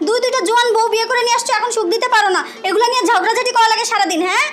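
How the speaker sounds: angry scolding. Acted. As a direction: berate him in a rapid rush. First wife berates husband in quarrel. (রেগে দ্রুত বকাঝকা)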